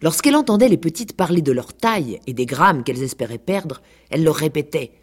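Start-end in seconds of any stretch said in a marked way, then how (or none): none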